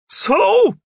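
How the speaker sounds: high, loud one-word challenge; loud, high-pitched questioning shout; yelled retort. A narrator's reading. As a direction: yell it back, unconvinced. Jeff yells back at Roy.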